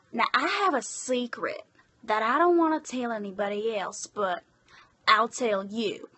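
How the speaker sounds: attempted Boston accent slipping into Southern; urban Boston accent. Voicing a requested character sharing a secret, Southern-tinged Boston accent. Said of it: "an urban Boston accent type thing".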